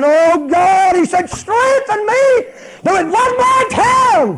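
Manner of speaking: high, slow, very loud plea; crying out to God. Acted. Samson calling on God for strength, crying out at full force. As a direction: build to a full-force prayer shout. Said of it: "He got to calling on God".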